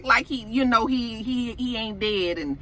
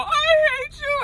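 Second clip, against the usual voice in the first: tearful screaming accusation at her mentor; crying. Retold or imitated. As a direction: wail it out through tears, voice up high. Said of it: "she starts crying".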